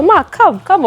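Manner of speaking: high-pitched beckoning call; calling someone over. Real, not acted. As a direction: call the guest over brightly, pitch jumping up. Calls the guest over to camera.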